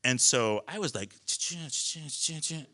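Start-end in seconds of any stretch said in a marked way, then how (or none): 1.2-2.6 quick, soft "ch-ch" clicking sounds; imitated unstrapping noises. Parasailer getting out of straps, imitated clicking. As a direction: mouth the clatter of straps coming undone.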